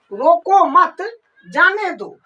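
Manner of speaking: रोको मत as one phrase, pause, जाने दो; pause after मत. Shown as a demonstration. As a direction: break the phrase right after मत. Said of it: "कमा लगाने का मतलब". (मत के बाद विराम)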